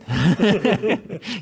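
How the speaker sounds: bursting out laughing; big delighted laugh; loud laugh. Real, not acted. Laughs at own retraction.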